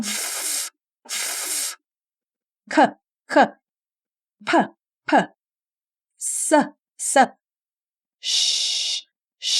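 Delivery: isolated f, k, p, s, sh with puffed air; voiceless consonants. Shown as a demonstration. Teacher lists voiceless endings, puffed without voice. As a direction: push air through "f", "k", "p", "s", "sh", no voice. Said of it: "ลมมันจะพ่นจากริมฝีปากเรามากหน่อย".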